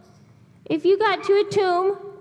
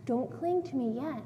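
low, soft, measured reply; hushed and low, with reverent pauses; Jesus speaking gently; 6 semitones lower, 11 dB quieter than usual. Retold or imitated. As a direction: voice a quiet, solemn teacher addressing a follower. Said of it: "And he says".

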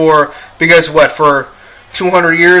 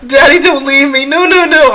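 high-pitched mock child pleading; much higher, imitated desperate begging; imitating crying daughter; 10 semitones higher than usual. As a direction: plead like a distraught little girl, hammed-up. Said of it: "she was calling him daddy".

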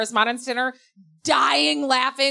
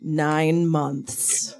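mom's flat verdict; 7 semitones lower than usual. Retold or imitated. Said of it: "mom's verdict".

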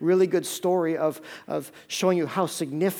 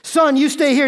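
urgent order; 8 semitones higher, 7 dB louder than usual. Retold or imitated. Father orders son urgently.

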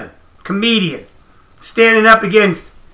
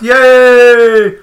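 sarcastic yay, a bit louder; mock cheering; 4 semitones higher, 6 dB louder than usual. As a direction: fake celebration, dripping with irony.

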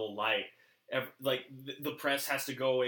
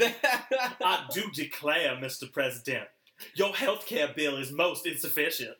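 put-on Southern belle accent; 3 semitones higher, 4 dB louder than usual. To the President about healthcare, Southern belle accent. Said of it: "same accent as Scarlett O'Hara".

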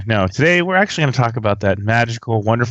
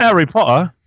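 Cockney try; 7 semitones higher than usual. Shown as a demonstration. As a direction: have a go at a Cockney accent. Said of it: "I used to sound really Cockney".

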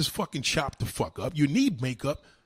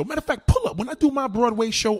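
hyped boast; 8 semitones higher, 5 dB louder than usual. Real, not acted. Boasting loudly about his show.

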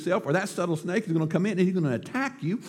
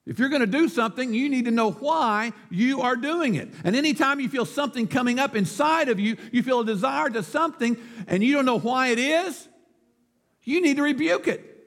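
higher, projected sermon delivery; emphatic preaching; 8 semitones higher than usual. Real, not acted. Preacher urging Christians to speak up, emphatic. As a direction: drive each phrase home to the congregation.

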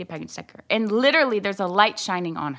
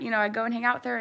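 ordinary talk

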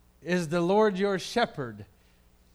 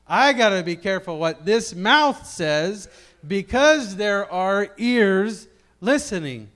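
emphatic; 5 semitones higher, 9 dB louder than usual. Shown as a demonstration. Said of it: "put that in the adult perspective".

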